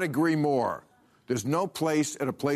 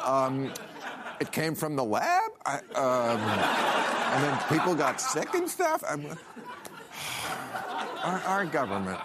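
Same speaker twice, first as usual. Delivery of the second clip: put-on valley girl voice; slower than usual. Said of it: "They're talking like a valley girl?".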